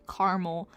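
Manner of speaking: "Caramel" said in two syllables as CAR-mel, the middle a dropped; CAR-mel pronunciation. Shown as a demonstration. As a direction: say "Caramel" as karmel, two beats only. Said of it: "I say caramel".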